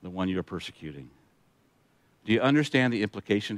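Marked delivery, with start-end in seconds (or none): 0.0-1.1 low, soft, unhurried divine reply; quiet, measured answer from heaven; lightly voiced Jesus. A narrator's reading. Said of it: "And the voice replied".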